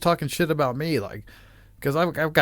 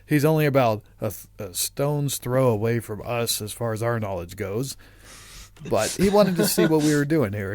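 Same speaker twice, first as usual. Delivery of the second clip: ordinary talk, nothing marked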